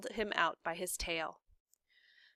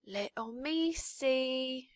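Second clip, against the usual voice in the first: slow dim maid; 5 semitones higher, much slower than usual. A narrator's reading. Mig addressing mouse, slow.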